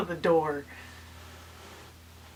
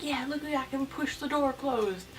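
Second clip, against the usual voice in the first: put-on higher-pitched, mildly cocky kid quote; lightly acted show-off line; retold childish boast; 6 semitones higher than usual. Child showing off that they can push the elevator door, mildly boastful.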